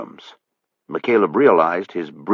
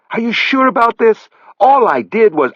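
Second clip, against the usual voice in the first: much higher, louder plea; pleading Buddy; 6 dB louder than usual. A narrator's reading. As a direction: beg him to reconsider. Buddy pleads one last time.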